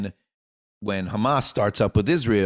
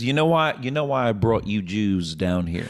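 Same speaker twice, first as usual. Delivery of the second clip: Kentucky booker. Retold or imitated. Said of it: "So he says".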